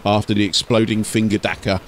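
steady storyteller read of an ork tale; story narration. A narrator's reading. Narrator telling an ork story to listeners, storytelling voice. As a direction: carry the listener through the scene.

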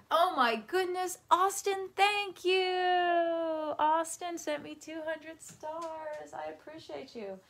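high, gushing thank-you; excited thanks. Real, not acted. Streamer thanking viewer Austin for stars, high and gushing. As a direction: gush over a generous gift.

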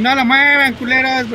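high, loud shout at the screen; angry yell. Real, not acted. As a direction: bark it at the opponents. Yelling at the game.

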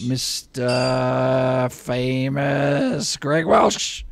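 very slow, stretched-out showman introduction; drawn-out announcer intro. Pretend. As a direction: draw out each word like a ring announcer. Host announces guest with drawn-out fanfare.

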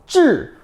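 쯔 falling sharply from high; fourth tone. Shown as a demonstration. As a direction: drop 쯔 fast from the top. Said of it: "사성".

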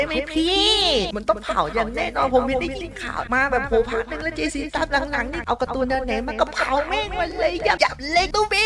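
imitating whining complainers, voice pitched high; high mock gripe, dragging out the line ends; คนดูโวยวาย. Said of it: "หลายคนบอก". (เลียนเสียงคนดูบ่นโวยวาย)

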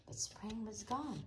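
ordinary delivery, nothing marked

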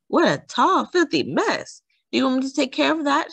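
high, shocked neighbour; gasped dismay. A narrator's reading. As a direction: exclaim in dismay at the mess. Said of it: "gasped Senora Soledad when she saw Dahlia".

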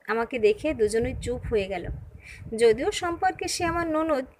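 plain reading voice

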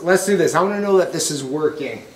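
normal talking voice

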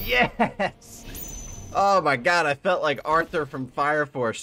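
loud, high triumphant cheer; elated shout. Real, not acted. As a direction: burst out in relieved victory. Elated after winning the fight.

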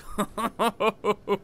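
short run of chuckles; laughing. Real, not acted. Gamer watching the doomed towns, chuckling. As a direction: chuckle in a quick string. Gleeful at towns about to burn.

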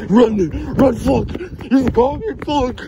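high, loud panicked shouting; panicked yell. Real, not acted. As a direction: scream while running away. Caught by the seeker, yelling.

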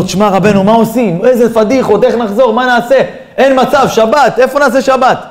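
worked-up stranded traveller; panicked passenger. Acted. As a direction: plead in mock alarm, question piling on question. Panicked passenger who missed his stop.

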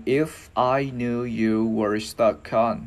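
very slow, drawn-out English demonstration; careful, measured teacher's pronunciation; slow model phrase. Shown as a demonstration. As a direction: stretch each word for learners to copy.

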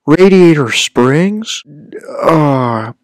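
high, swooping drawled actor impression; Owen Wilson. Streamer trying a voice known for saying wow, terrible actor impression. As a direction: stretch each word up and over, terribly imitating the actor. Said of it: "a terrible Owen Wilson".

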